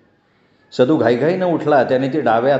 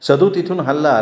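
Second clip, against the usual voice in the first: usual speaking voice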